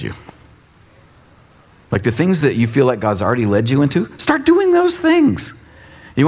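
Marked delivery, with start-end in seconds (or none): 4.2-5.5 high stretched urging. Real, not acted. Preacher urging action.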